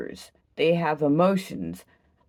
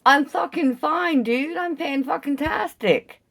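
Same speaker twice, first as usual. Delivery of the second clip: defiant high retort; 9 semitones higher than usual. Real, not acted. Defiant reply to the bystander.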